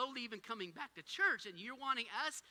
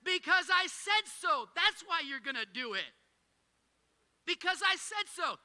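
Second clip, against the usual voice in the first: loud, high, slow, exasperated parent; frustrated parent; 7 semitones higher, 8 dB louder than usual. Retold or imitated. Fed-up parent telling his kids, loud and slow. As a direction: spell it out like a fed-up dad. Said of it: "I'd get so frustrated and infuriated".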